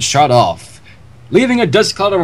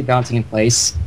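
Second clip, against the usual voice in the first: ordinary delivery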